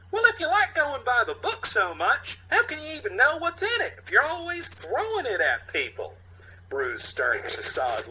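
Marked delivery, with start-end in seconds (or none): 0.1-6.2 high, loud sneering voice; mocking high character voice. Youth needling the stern cop, in a high mocking voice.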